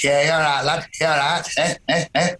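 local farmer's chuckling voice; Tommy Shack. Acted. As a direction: voice an old-country farmer, agreeing with a chuckle. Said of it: "a local farmer called Tommy Shack".

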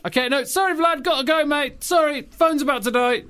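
high-pitched mock panic, hurrying off the call; flustered excuse. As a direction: babble a comic excuse up high. Acted president flees the phone call.

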